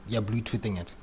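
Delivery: ordinary talk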